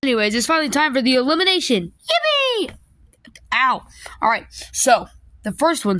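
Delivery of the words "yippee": very high squeal of joy; high cheer. Acted. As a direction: squeal with delight.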